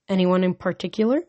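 plain reading voice

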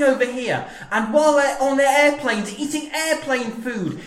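high-pitched, excitable sports commentator; Murray Walker. Acted. Comedian answers a challenge, high commentator impression doing Seinfeld routines. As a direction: deliver stand-up patter like a race commentary. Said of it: "an impression of Murray Walker".

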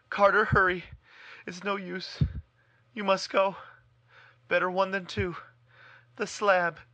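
high, loud, frantic pleading; desperate urging. Acted. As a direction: push him to run, gasping. Warren urges Carter to flee.

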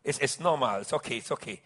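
soft, low reassurance; reassuring. Retold or imitated. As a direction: ease his embarrassment gently. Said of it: "I said".

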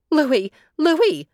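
high, loud call to a neighbor; storybook call for help; breathless cry. A narrator's reading. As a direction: cry the name out urgently, pitched high. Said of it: "she cried out of breath".

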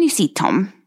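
normal talking voice

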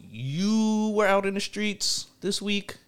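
slow, high teasing. Acted. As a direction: tease him about it. Teasing line, then restated plainly.

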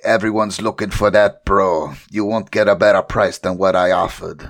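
doc's line in a mock Jamaican accent; put-on Jamaican-style accent. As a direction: play the dealer with a rough Jamaican twang. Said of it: "Accent there".

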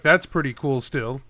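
ordinary delivery

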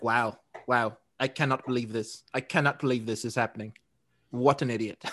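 low, soft attempt at a British accent; bad British accent. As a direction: do a half-hearted, pretty terrible British accent. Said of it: "that was your British accent?".

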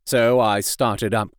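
ordinary delivery, nothing marked

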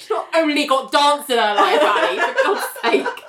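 high, exasperated and laughing; laughing exasperation. Real, not acted. Host objecting to Ali's comment, laughing exasperation. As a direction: protest through laughter.

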